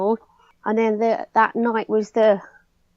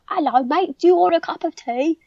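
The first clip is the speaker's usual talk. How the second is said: higher-pitched put-on British accent; mock British accent; 7 semitones higher, 4 dB louder than usual. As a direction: imitate someone showing off their best British accent. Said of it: "my best British accent".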